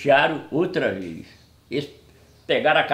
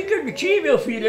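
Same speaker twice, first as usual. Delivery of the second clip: mock sweet greeting; 9 semitones higher than usual. Narrator greets Carlitinho once more.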